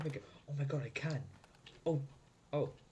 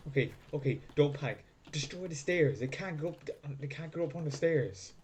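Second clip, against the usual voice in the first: ordinary talk, nothing marked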